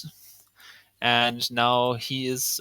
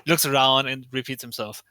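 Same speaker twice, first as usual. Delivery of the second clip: ordinary talk, nothing marked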